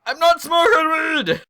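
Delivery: slow, high, drawn-out mock shout; shouted protest. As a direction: yell the denial back, stretching it out. Retold denial escalates to a shout.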